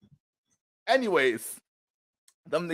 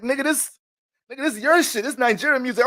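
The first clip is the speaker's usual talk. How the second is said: acted exasperation; 10 semitones higher, 3 dB louder than usual. Acting friends' exasperated reply.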